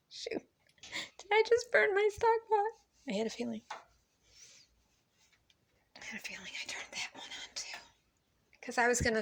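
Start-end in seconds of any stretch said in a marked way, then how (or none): 0.4-3.1 much higher, slow alarmed question; sudden alarm at the stove; shocked she may have burned the pot; alarmed surprise. Real, not acted. Discovers the pot may be burnt. 3.1-7.8 whispered, much softer. Real, not acted. Muttering to herself over the stove.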